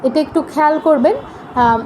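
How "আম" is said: ordinary talk, nothing marked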